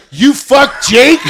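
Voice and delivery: loud, high, drawn-out incredulous question; mock shocked outburst. Husband learns his wife dated Jake, hammed-up shock. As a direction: shout it slowly in comic disbelief.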